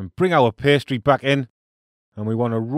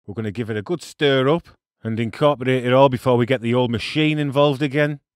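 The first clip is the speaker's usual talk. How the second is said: ordinary delivery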